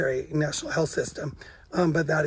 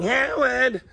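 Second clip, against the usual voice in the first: higher call of Howard!, mother from Big Bang; Mrs. Wolowitz; 7 semitones higher, 3 dB louder than usual. Retold or imitated. Imitating Mrs. Wolowitz calling her son, higher. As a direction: holler Howard! up the stairs. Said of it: "That's what I sound like".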